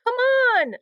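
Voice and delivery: high, loud call across the snow; shout. A narrator's reading. Heidi calling Henry off the snowbank, shouting. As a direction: shout to him from afar. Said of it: "Heidi shouted to him".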